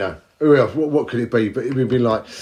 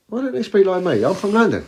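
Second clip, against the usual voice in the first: higher-pitched, mock-baffled protest; raised, plaintive questioning; indignant complaint; 8 semitones higher than usual. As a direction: voice a put-out local's complaint. Voicing own baffled complaint aloud.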